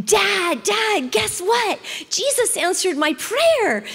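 high, loud and eager, the last line slowed; excited little girl. Retold or imitated. Girl running to her dad after school, high, loud and excited. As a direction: burst in with big news like a thrilled child. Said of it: "she was so excited".

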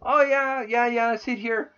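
put-on, sarcastic compliance; mock agreement. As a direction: play along in a mocking high voice. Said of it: "I'm sitting here going".

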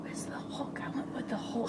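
whispered aside; hushed conspiratorial whisper. Acted. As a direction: whisper it like a secret. Secret aside during shared story.

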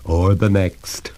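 ordinary delivery, nothing marked

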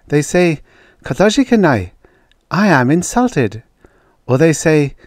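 high, keen scientist making her case; earnest woman researcher. Acted. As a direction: put your case to sceptics.

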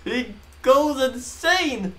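high, slow cry of amazement; excited exclamation. Real, not acted. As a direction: stretch it out in disbelief. Excited about the rescue scene.

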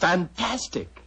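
slow, high, drawn-out fantastic; amazed. Acted. Murphy marvels at Holmes's trick, amazed. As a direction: savour the wonder of it.

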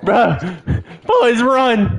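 high urgent warning; panicking gamer. Real, not acted. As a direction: tell your squad to flee. Fleeing the creature.

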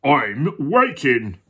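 drawn-out duck line; slow, sing-out duck complaint. Acted. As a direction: stretch it out slowly as a duck. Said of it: "said the duck".

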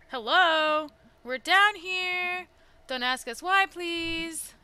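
loud, slow call up from a well, laughing into it. Acted. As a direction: shout up to whoever might hear. Calling up out of well.

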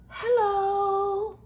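high, sung-out tiny hello; little fairy voice. A narrator's reading. Fairy greeting the girl from a flower, little high voice. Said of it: "said a little voice".

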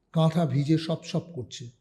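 plain reading voice

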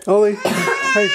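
slow, delighted call to the dog; excited. Real, not acted. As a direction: cheer her name out with a laugh. Cheering dog's trick.

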